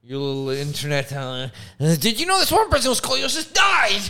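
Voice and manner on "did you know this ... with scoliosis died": loud, high mock alarm about a fact read online; mock alarmed friend. Mocking a friend who shouts scary facts from the internet. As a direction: blurt it like a panicked know-it-all.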